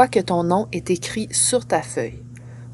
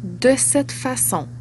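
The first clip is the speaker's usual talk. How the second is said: dictating; 4 dB quieter than usual. Real, not acted. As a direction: read each chunk with long pauses. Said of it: "Je vais lire chaque phrase partie par partie".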